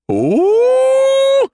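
long, very high, loud "ooh"; drawn-out squeal of delight; delirious excited squeal. A narrator's reading. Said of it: "delirious excitement".

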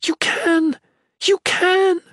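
very high, urgent shouts; desperate yell. A narrator's reading. Shalane urging Shatan to act, desperate yelling. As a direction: urge on loudly at top pitch. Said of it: "the Shalane yelled".

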